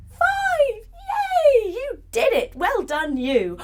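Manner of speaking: high, loud celebration; excited cheer. Pretend. Teacher celebrates children balancing to five, excited. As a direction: squeal the last count and cheer.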